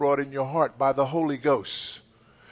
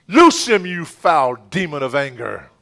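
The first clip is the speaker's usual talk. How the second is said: commanding shout; 2 semitones higher, 14 dB louder than usual. Real, not acted. Pastor ordering anger out of a man, commanding shout. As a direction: shout it like you're breaking chains.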